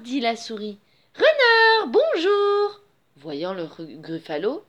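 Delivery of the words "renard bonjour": higher, drawn-out greeting; calling out. A narrator's reading. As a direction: hail someone in a singsong. Mouse calls out to the fox. (salut chantant et aigu)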